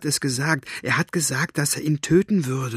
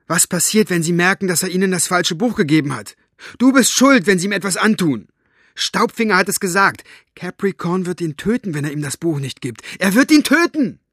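loud, high, rising to a desperate cry; loud again; 6 semitones higher, 5 dB louder than usual. Acted. Girl shouting at her aunt about her father's life. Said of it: "Maggies Stimme wurde wieder laut".

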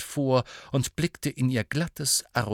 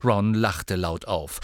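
ordinary delivery, nothing marked

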